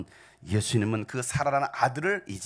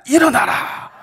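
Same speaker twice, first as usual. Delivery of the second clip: much higher, louder commanding shout; piercing shout; 11 semitones higher, 11 dB louder, slower than usual. Acted. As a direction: cry out to raise the dead. Commanding the dead to rise. (높고 크게 외치는 명령)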